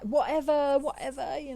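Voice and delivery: put-on high, loud American accent; imitating an American accent. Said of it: "I cannot do the American accent very well".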